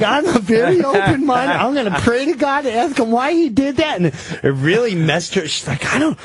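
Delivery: quoting his wife, fretting about God's plan; wife's voice. Retold or imitated. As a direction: lift the voice up as the puzzled wife. Voicing his born-again wife.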